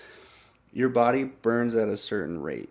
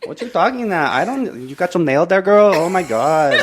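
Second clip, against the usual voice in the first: high, loud, sassy camp voice; 7 semitones higher, 12 dB louder than usual. Pretend. Guest trying voices for the hosts, mock camp caricature. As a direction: exclaim it as a campy caricature.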